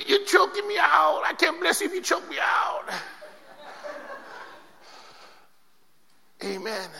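high strained voice, being choked; choked angel voice. Acted. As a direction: squeal like someone choking.

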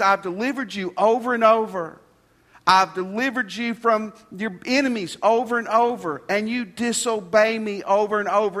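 loud, high insistence, dropping at the end; preached divine rebuke. Acted. Preacher speaking as God to disobedient Israel, forceful rebuke. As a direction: drive home each repeated charge.

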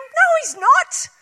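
high, loud, defiant outburst; silent scream. Retold or imitated. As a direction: rant like a panicked control freak. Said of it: "we silently scream".

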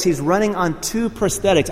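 ordinary delivery

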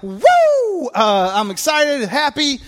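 high hammed-up whoop; mock excitement. Describing shallow joy, high mock whoop. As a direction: cheer like a fan, overdone. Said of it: "kinda like".

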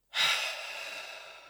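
long airy exhale without voice; demonstrated sigh. Shown as a demonstration. Meditation guide breathing with listeners to relax, long sigh. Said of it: "You can even add a sigh".

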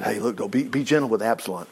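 low, soft request; gentle plea. A narrator's reading. David asks Joab to spare his son, gentle plea. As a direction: plead quietly, well down in pitch.

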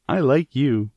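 high-pitched, excited declaration; crying out. A narrator's reading. Mary running after the robin, crying out excitedly. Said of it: "she cried out".

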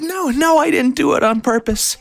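very high, loud frantic mom; frantic denial. Retold or imitated. Mom insisting to poison control, high frantic.

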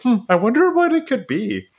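high, put-on voice playing dumb innocence; mock clueless Adam.

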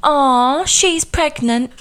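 high, slow cooing "aw"; cooing stranger. Retold or imitated. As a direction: coo like a soppy stranger on a bench. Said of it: "she went".

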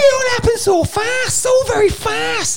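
very high-pitched hammed-up Scouse; comically shrieky Liverpudlian; Liverpudlian accent. Shown as a demonstration. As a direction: squeal it in bad Scouse. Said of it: "let's do a Liverpudlian".

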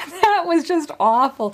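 laughing breathily. Real, not acted. Laughing after the slip.

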